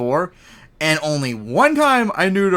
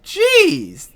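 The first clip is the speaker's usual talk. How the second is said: surprised. Real, not acted. Reacting to a shocking statistic.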